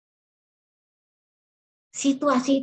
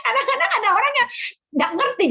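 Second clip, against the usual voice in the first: laughing; 11 semitones higher, faster than usual. Real, not acted. Laughing about confused church members.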